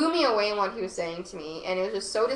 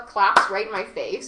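ordinary talk, nothing marked

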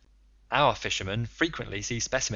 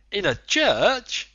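much higher, louder, slow, rising on church; astonished exclamation; 10 semitones higher, 8 dB louder, much slower than usual. A narrator's reading. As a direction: stretch out church in utter disbelief. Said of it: "the Canadian exclaimed".

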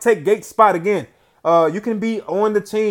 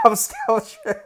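breathless laugh; slower than usual. Real, not acted. Laughing after the game win.

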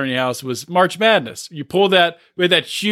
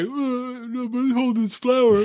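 higher-pitched mock helpless voice; hapless helper impression; 6 semitones higher, 4 dB quieter than usual. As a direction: play a put-upon bumbler, hammed up. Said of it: "he's doing a terrible job, just like".

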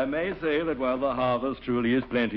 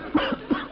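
startled choking cough. Acted. Chokes at mention of young people.

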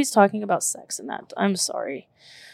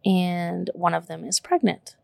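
usual speaking voice

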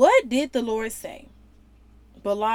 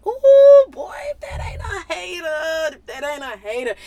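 incredulous exclaim; 6 semitones higher, 8 dB louder than usual. Real, not acted. Preacher mocking Balak's demand.

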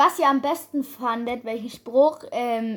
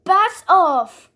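loud; 5 semitones higher, 6 dB louder than usual. Retold or imitated. Repeating the voice line twice.

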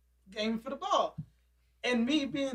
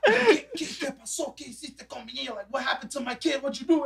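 hammed-up Spanish-speaking mom demanding answers; imitating an alarmed Mama Bear; protective angry mother; 3 semitones higher than usual. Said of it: "and then she comes out".

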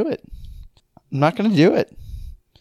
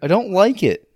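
upset protest; 7 semitones higher, 4 dB louder, slower than usual. Real, not acted. Upset refusing the question.

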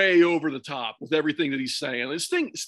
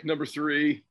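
ordinary talk

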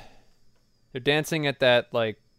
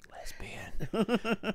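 imitated whisper; 4 semitones higher, 10 dB quieter than usual. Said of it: "you just, like, whispered".

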